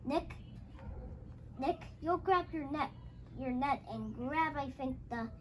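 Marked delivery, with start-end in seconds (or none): none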